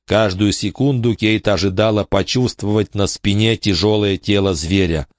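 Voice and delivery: plain reading voice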